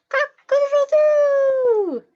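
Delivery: high, loud, drawn-out cock-a-doodle-doo; stretched rooster call at daybreak; rooster crow. A narrator's reading. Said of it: "says the rooster".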